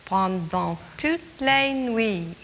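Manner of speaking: slow "Pendant toutes les nuits"; model French line. Shown as a demonstration. Teacher modelling the line for children to repeat. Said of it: "You say it".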